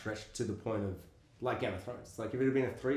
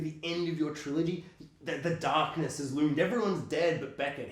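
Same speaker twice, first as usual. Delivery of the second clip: normal talking voice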